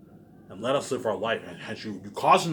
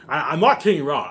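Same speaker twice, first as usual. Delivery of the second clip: louder, higher, fed up; raised voice, done warning them; angry shout; 4 semitones higher, 8 dB louder than usual. Real, not acted. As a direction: bark it at them, furious. Angry rant at viewers.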